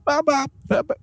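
high, squeaky attempt at clucking; badly faked chicken, straining for falsetto; failed chicken impression. Shown as a demonstration. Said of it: "I can't even chicken impre".

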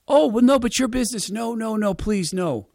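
high, falling protest; lightly voiced protesting neighbor. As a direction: deflect the praise.

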